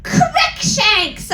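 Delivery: high mock shout; shouted name. Narrator yelling at Voldemort, high mock shout. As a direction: call the name out high and sharp. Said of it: "I shouted at him".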